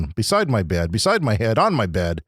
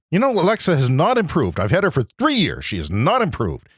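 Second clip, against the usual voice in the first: ordinary talk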